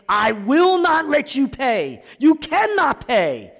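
slow, high, anguished shout; loud impassioned cry, words stretched. Acted. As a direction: call it out with all your force. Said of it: "he's weeping and he's crying and he's calling out".

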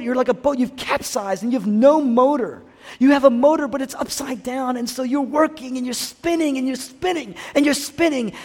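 high-pitched, driving, breathless-sounding pile-up of phrases; impassioned urgency. Acted. Preacher as Jesus telling Martha she's in a storm, urgent and high. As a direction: build a whirl of urgency, easing briefly near the middle.

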